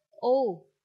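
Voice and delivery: "U" called out loud and clear, on its own; spelled-out sound. Shown as a demonstration. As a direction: say "U" firmly and loudly as a teaching example. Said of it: "âm đầu". (đọc to, rõ chữ "U")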